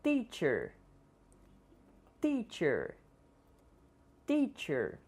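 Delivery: teacher with a plain t, not tchee; English t. Shown as a demonstration. Teacher models English teacher for learners. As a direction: start teacher with a clean t. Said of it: "Iremos pronunciar".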